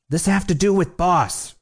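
high-pitched call through the door. A narrator's reading. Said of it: "Rat called".

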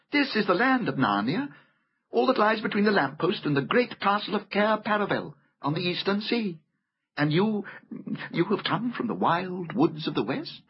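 high-pitched storybook fawn explaining his land; courteous fawn, full of wonder. A narrator's reading. Voices the fawn.

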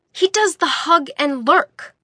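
열정적으로 목소리를 높여서. A narrator's reading. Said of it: "his pitch rose to get his point".

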